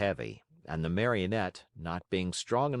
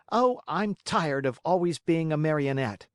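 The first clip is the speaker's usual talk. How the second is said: put-on high, loud puppet-boy complaint; disgusted Pinocchio; 8 semitones higher, 5 dB louder than usual. A narrator's reading. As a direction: cry out fed up, pitched high. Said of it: "cried Pinocchio disgustedly".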